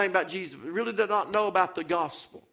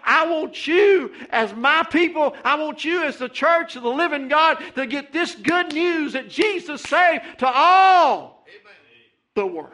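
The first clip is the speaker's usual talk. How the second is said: high, loud build to a shout on all; preaching climax; 5 semitones higher, 4 dB louder than usual. Real, not acted. Preacher urging his people, rising to a shout.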